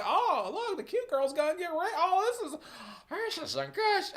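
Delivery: high, gushing fake enthusiasm; sarcastic cheerful viewer voice; mock delighted viewer. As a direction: pretend to relish it in a squeaky voice. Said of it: "there is not an ounce of me that's like".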